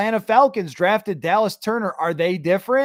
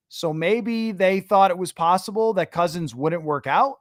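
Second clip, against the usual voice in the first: usual speaking voice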